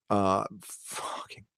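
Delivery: slow unvoiced whisper of fucking; slow whispered expletive; whispered curse. Real, not acted. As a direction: breathe the curse out under your breath. Aside while naming favorite band.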